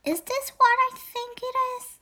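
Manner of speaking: high, amazed question; surprised. Acted. Owl child finding a treasure clue, amazed. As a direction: gasp it out in wonder.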